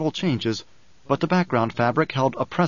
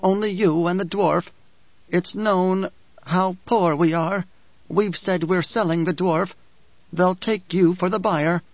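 higher character voice, measured and firm; Dory, firm woman; 5 semitones higher than usual. Acted. Dory urges Paul to take the dwarf, firmly. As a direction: lift the voice for a resolute woman.

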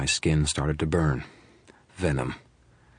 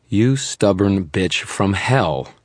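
slow, louder, fed up; exasperated; 4 semitones higher, 4 dB louder, much slower than usual. A narrator's reading. Dresden exasperated at stubborn poisoned Murphy. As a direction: drag it out in disbelief.

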